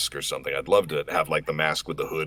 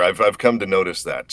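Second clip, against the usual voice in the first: ordinary delivery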